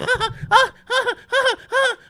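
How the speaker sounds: high, breathy villain cackle; maniacal laugh. Retold or imitated. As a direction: finish with a crazed cackle. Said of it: "He's running around going".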